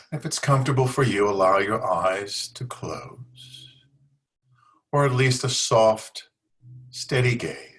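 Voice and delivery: unhurried meditation guidance with long pauses between phrases; guided yoga nidra. Real, not acted. Leads a yoga nidra practice.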